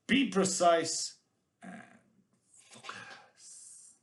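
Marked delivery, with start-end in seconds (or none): 1.6-4.0 whispered, drawn out. Pretend. Teacher urging students to concentrate, whispered. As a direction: hush it to a near-silent whisper. Hushed word after emphatic commands.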